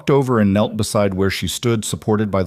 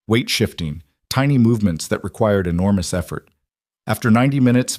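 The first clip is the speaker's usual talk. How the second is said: usual speaking voice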